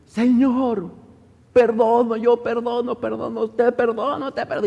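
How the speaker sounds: high, insistent imitated cry to God. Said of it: "de repente grita". (grito agudo de perdón, imitado)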